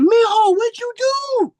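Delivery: very high, loud mock nurse voice; exaggerated high-pitched imitation of a startled nurse; shocked little nurse. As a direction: squeal it like an alarmed nurse. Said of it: "The little nurse came out".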